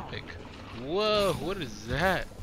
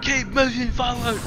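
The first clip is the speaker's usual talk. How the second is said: shouting; 9 semitones higher, 4 dB louder than usual. Real, not acted. Urging himself on in game.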